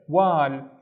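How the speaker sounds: WAL with the a held long; drawn-out "Wal"; long vowel. Shown as a demonstration. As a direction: repeat "Wal" with a stretched aa. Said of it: "twice as long because of the alif".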